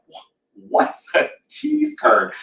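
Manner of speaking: high, slow, breathy puzzlement; puzzled question. Retold or imitated. Moved to the Midwest, puzzled at the menu, asking in surprise. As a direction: ask it baffled by the strange food.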